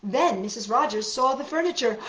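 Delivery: ordinary delivery, nothing marked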